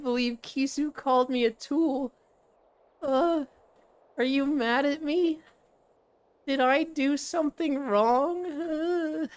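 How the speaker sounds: high, drawn-out fake whimper; mock hurt. Streamer pretending to be hurt at being called a tool, mock tearful. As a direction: pout and whine as if about to cry, hammed up.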